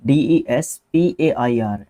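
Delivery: teacher spelling it out slowly. Shown as a demonstration. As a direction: spell डीईएसपीएआईआर letter by letter, slowly. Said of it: "ऐसे होता है".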